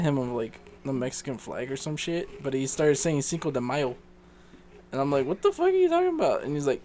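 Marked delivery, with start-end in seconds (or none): 5.3-6.4 very high, fast incredulous question; baffled protest. Retold or imitated. Son answering dad's random remark, baffled protest. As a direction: squeak it out quickly in bewilderment.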